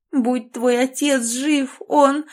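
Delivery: slow, high tragic lament; mother lamenting the late father; dying voice. A narrator's reading. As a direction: trail off in mock grief. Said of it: "умирающим голосом простонав".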